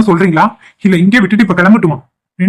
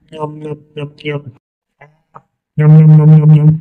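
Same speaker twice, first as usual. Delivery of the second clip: munch steadily through the bite; pretend munching; 3 semitones lower, much slower than usual. Child fed by mother at dinner, munching.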